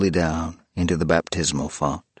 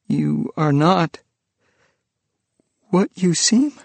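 forced question; 9 semitones higher, 3 dB louder than usual. Acted.

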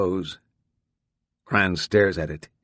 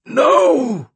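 a much higher, louder desperate scream of No; anguished scream; 7 dB louder than usual. Acted. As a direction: scream as the square starts to blink. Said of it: "Cran gasps".

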